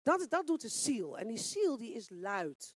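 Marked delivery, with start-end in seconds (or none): none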